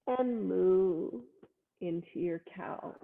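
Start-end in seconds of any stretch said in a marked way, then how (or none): 0.5-1.1 a drawn-out, playful cow moo. Shown as a demonstration. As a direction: moo like a friendly cow for the kids. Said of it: "You can do a little moo here if you like".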